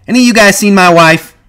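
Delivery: loud, high, agitated demand. Acted. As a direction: burst in demanding an answer. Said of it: "he demanded".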